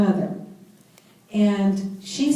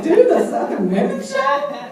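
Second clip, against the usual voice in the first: higher, louder, laughing disbelief; incredulous laughing repeat; 6 semitones higher, 4 dB louder than usual. Retold or imitated. Woman repeating God's odd message, laughing in disbelief. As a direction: repeat the words as if they're absurd. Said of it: "I was like".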